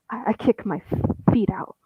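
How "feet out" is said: ordinary delivery, nothing marked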